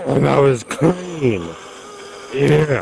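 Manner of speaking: loud, slow triumphant shout; excited cheer. Real, not acted. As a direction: whoop it up after a clean shot. Clean kill, cheering.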